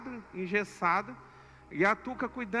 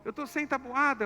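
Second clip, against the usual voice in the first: put-on much higher, louder child's whine; upset daughter whining; 8 semitones higher, 5 dB louder than usual. Daughter pleading with her dad over the missing times table, higher and louder. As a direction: whine like an eight-year-old who's lost her homework.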